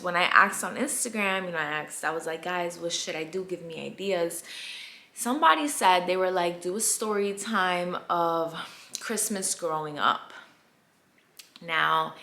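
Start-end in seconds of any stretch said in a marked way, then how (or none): none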